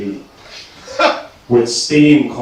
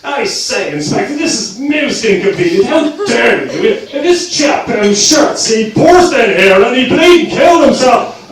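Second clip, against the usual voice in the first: posh English, pitched high, sliding to an angry Dublin rant, louder at the end; 12 semitones higher, 7 dB louder than usual. Retold or imitated. Angry character berating a suspicious police inspector, posh turning Dublin. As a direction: start plummy and outraged, then lose it into Dublin fury. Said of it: "started off as a posh English accent, but ended up as a Dublin scumbag having a fit".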